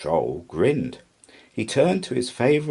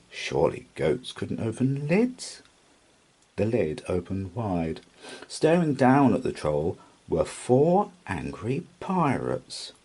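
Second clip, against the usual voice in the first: usual speaking voice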